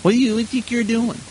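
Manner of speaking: mild mock outrage, pitch leaping up at the end; imagined indignation. Woman objecting to being called a dog, mild mock indignation. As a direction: play a woman lightly affronted. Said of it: "she could get all worked up and say".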